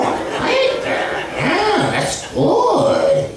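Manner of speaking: high breathy falsetto moaning; Marge. Retold or imitated. Marge moaning to Homer in bed, high falsetto. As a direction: coo and moan high as a mock Marge. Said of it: "she's like".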